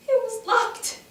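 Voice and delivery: very high, crying repeat; tearful despair. Acted. Survivor trapped at the shut door, crying in despair. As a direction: break into acted tears.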